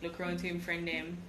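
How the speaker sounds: normal talking voice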